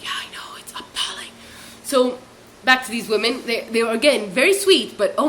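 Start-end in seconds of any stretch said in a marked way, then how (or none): 0.0-1.3 whispered, much softer reply; hushed agreement. Retold or imitated. Agreeing with the pilot who overheard, hushed whisper. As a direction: murmur it under your breath.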